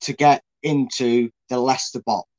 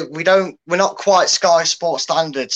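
usual speaking voice

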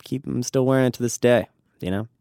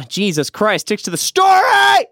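sudden loud exasperated shout; 12 semitones higher, 7 dB louder than usual. Real, not acted. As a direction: yell it at yourself, fed up. Self-mocking outburst at own tangent.

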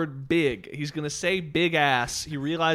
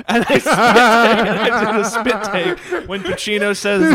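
loud, high, cracking up; helpless laughing retelling; laughing while talking; 6 semitones higher, 9 dB louder than usual. Real, not acted. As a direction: talk through real laughter. Cracking up at remembered line.

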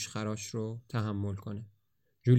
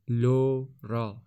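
tender; much slower than usual. A narrator's reading. Savouring her name, tender.

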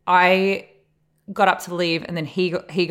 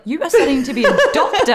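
much higher and louder, fast, through laughter; exasperated laughing; 9 semitones higher, 7 dB louder, faster than usual. Retold or imitated. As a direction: laugh in disbelief at her sister. Scolding sister in retold scene.

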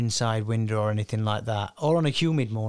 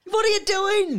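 very high, exasperated disbelief; incredulous outburst; 4 dB louder than usual. Real, not acted. Host challenging co-hosts over the stolen pick, incredulous. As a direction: protest in a high, astonished voice. Reacting to co-hosts' matching picks.

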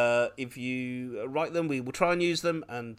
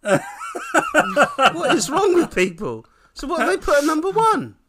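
high, loud laughing disbelief; laughing indignation; 8 dB louder than usual. Real, not acted. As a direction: laugh in mock outrage. Indignant at chart result.

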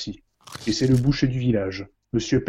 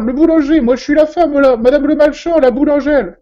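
high, loud shrieking woman's voice; panicked widow; 14 semitones higher, 10 dB louder than usual. Acted. Widow calling the police in a high, loud panic.

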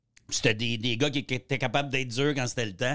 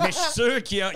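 laughing hard; 9 semitones higher than usual. Real, not acted. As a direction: laugh through the start of the line. Laughing about scared friends.

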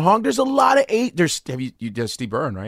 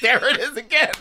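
laughing while talking; 11 semitones higher than usual. Real, not acted. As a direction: laugh through the words. Laughs at sarcasm.

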